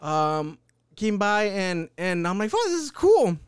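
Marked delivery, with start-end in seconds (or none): 2.5-3.4 awed exclaim. Retold or imitated. Awed reaction quote.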